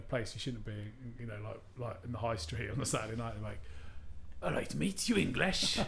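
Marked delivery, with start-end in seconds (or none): 4.4-5.8 a lightly done Welsh lad's greeting; mock Welsh accent, a bit higher. Drunk Welsh local greeting a student, in mock Welsh accent. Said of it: "That's terrible Welsh accent".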